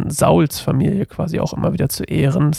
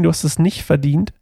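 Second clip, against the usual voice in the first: usual speaking voice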